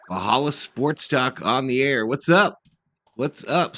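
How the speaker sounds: ordinary delivery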